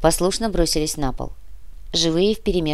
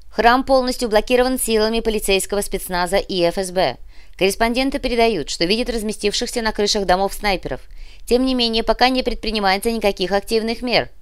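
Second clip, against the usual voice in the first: higher-pitched, pressing correspondent delivery; radio news report; 5 semitones higher than usual. Acted. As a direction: read the crisis update on air. Voices another radio station's report.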